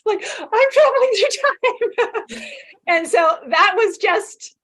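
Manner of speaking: high, loud, giggly telling; tickled by your own story, voice lifting high; laughing. Real, not acted. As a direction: tell it through bursts of laughter. Amused at own time travel story.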